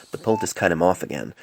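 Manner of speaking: plain reading voice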